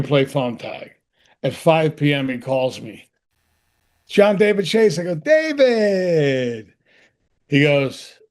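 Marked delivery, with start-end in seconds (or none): 4.1-5.0 higher-pitched imitation of a caller; imitated phone caller. Boss calling back at five, higher-pitched announcing. 5.2-6.7 high, long drawn-out hail, sliding down; hammed-up greeting. Actor answering his boss's call, drawn-out hearty hail. Acted eager phone greeting.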